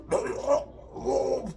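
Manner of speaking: high imitated retch; mock gagging. Brother-in-law smelling the tobacco, imitated gagging retch. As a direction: gag like you're about to puke. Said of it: "he's ready to puke".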